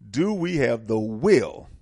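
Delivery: slow, deliberate question landing hard on "will"; stress on will. Real, not acted. Speaker recalls his closing challenge to listeners, stress on "will". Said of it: "I emphasized the word will".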